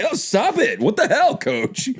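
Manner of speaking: high mock-distressed players; hammed-up high-pitched protest. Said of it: "They're like".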